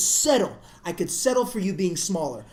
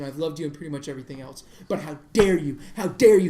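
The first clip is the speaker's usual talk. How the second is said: ordinary talk, nothing marked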